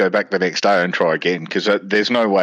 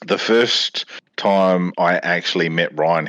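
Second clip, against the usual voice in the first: ordinary talk, nothing marked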